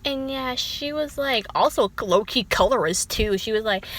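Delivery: ordinary talk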